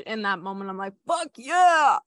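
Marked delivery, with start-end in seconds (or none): 1.1-2.0 high, loud retold cheer; triumphant shout. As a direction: shout it like a victory cry.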